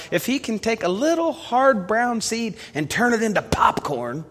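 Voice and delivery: high-pitched, marvelling pulpit build; impassioned preaching. Acted. Preacher telling church of God's power to transform, impassioned high preaching. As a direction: pile up the wonders in a lifted preacher's voice.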